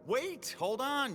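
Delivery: high, drawn-out cry of alarm; urgent, high-pitched protest. Acted. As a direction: stop them before someone gets hurt. Stopping the child from shooting.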